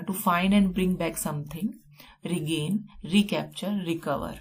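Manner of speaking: slow dictation pace. Shown as a demonstration. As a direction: read it out slowly for notes. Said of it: "teacher dictating definition".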